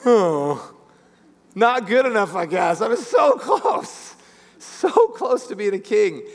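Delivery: mock disappointed sigh, cry out on so close; dejected rejected brother. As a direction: play the passed-over son, crushed.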